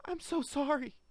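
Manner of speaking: a high, tearful apology; starting to cry. Acted. Wife apologising to her husband, starting to cry. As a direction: let the tears break into the apology. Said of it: "She started to cry".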